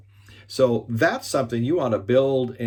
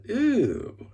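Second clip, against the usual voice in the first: a high, squeaky mock ew of distaste; disgusted ew; 12 semitones higher than usual. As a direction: imitate a kid's grossed-out ew. Daughter reacts to harsh tone.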